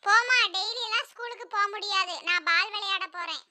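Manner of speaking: put-on soft, slightly thin child's voice; young son Jakku. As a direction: play a small boy refusing his mother. Son refusing school in story. (சின்னப் பையனின் மெல்லிய குரல்)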